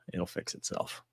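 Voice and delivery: normal talking voice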